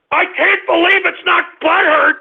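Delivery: high-pitched, indignant outburst; outraged cry. Real, not acted. Host fed up with being ridiculed, outraged cry. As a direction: wail it in fury.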